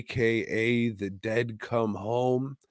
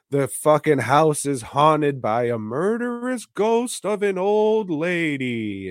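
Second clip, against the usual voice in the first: hammed-up horror-movie reveal, louder and higher; mock-dramatic reveal; 5 semitones higher, 4 dB louder than usual.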